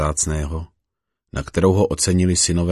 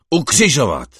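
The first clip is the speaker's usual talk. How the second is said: high, loud crowd yell; crowd shouting louder; 8 semitones higher, 6 dB louder than usual. Acted. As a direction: yell it even harder than before. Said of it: "Oni však křičeli ještě víc". (křik davu, výš a hlasitěji)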